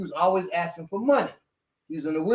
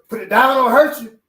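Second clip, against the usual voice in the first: raised, higher and louder warning; threatening command; 6 semitones higher, 10 dB louder than usual. Acted. A man facing someone with a knife, threatening command. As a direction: order them firmly to back down.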